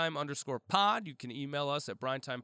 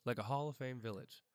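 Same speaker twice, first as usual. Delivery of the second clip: ordinary talk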